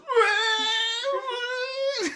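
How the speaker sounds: high, hammed-up bawling; mock wailing sob. Son outdoes his father's crying with a mock wail. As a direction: wail like a child trying to outdo his dad. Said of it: "starts crying even harder".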